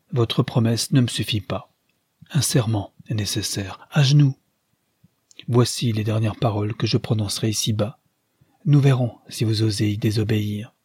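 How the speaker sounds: usual speaking voice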